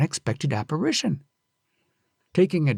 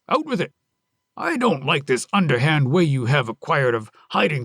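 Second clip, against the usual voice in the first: suspicious husband; 3 semitones higher than usual. Acted. Husband accusing Onami of hiding someone, angry. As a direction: press her hard for the truth.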